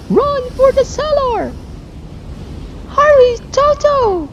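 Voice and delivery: very high, hurried panic; urgent alarm. Acted. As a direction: hurry everyone down to safety, thin high voice. Aunt Em urges into cellar.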